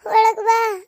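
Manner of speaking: high, loud call from the doorway, falling at the end; loud welcoming call, falling close; mother calling her in. Retold or imitated. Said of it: "ಅಂತ ಕರಿತಿದ್ಲು ನಮ್ಮವ್ವ".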